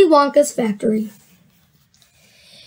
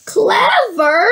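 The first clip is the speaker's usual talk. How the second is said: scoffing rising cry; indignant surprise; 5 semitones higher than usual. A narrator's reading. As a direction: echo it as if the word is far too small. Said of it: "cried the old man".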